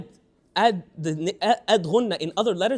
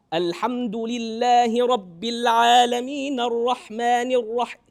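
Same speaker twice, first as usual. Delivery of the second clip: louder, slow open chant without nasal ring; sound pushed down; 4 semitones higher, 6 dB louder, slower than usual. Shown as a demonstration. Teacher showing the fix for nasalization, loud pushed-down chant. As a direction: recite slowly, higher and full, sound driven down. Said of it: "if I push the sound down".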